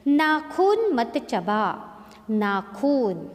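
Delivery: नाखून with खू slow and loud; slow stressed खू. Shown as a demonstration. Teacher draws out नाखून for pupils. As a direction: stretch खू in नाखून. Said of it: "बड़े ऊ की मात्रा जोड़ी गई है".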